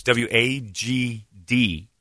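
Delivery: slow letter-by-letter readback; imitated caller checking each letter; unsure spelling. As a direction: spell it back slowly, landing on D. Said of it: "are you saying D?".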